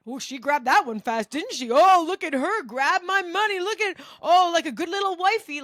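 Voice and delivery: high, loud jeering taunt; gloating sing-song mockery; high, loud, sneering drunk jeer; mocking laughing heckler. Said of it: "he was laughing at it".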